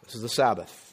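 soft, low mock disapproval; disapproving thought. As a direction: quietly voice a reader's misgivings. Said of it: "their immediate thought would have been".

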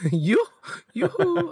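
high falsetto yoo-hoo through a laugh; sing song yoo hoo. Retold or imitated. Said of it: "You kinda sounded like the Frozen guy".

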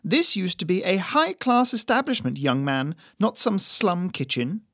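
put-on high, loud, indignant old lady; haughty old woman. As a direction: look down your nose at the staff. Doris the old woman voiced.

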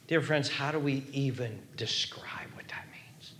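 low, very soft near-whisper; awed hush. Real, not acted. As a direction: sink into a hushed, wondering question. Awed question after God's words.

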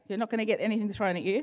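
ordinary talk

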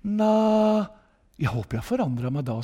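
subdued imitation of a doubtful churchgoer; imitated hesitant reply. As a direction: quote her quietly, thinking it over first. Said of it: "så tenker du litt".

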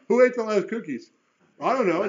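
put-on loud, high childish back and forth; mock parent and child exchange; parent and little kid. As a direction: act out a parent quizzing a kid, then the kid's denial. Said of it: "something your kids would say".

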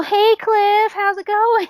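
high, loud, overfriendly hail; cheery calling out. Retold or imitated. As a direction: call out to a passing acquaintance. Calling out to passing line worker.

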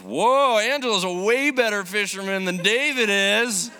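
loud, high, gleeful dad voice, mock-amazed; teasing dad. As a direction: crow it like a dad ribbing his son. Dad teasing about fishing.

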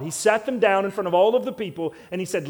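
ordinary talk, nothing marked

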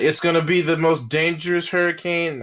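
high, loud imitation of a hyped forecast; mock-dramatic storm warning; mock alarmist. Mocking the hyped hurricane forecast.

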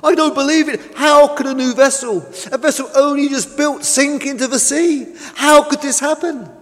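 high-pitched, incredulous protest; shocked disbelief. A narrator's reading. Tug laments the lost vessel, aghast and high.

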